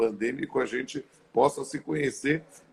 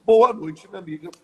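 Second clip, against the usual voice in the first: big, high, loud greeting; loud welcome; 6 semitones higher, 7 dB louder than usual. Real, not acted. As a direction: call out the hello. Calling out a welcome to the guest.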